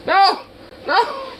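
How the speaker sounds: frantic rising protest. Real, not acted. Chasing dog, rising protest.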